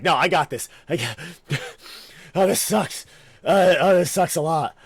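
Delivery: loud, winded complaining while running; straining runner. Acted. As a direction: groan it out, running out of steam. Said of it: "you're like".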